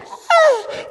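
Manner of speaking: high frightened ah; scared yelp. Retold or imitated. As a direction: let out a high scared cry. Retold scream of fear.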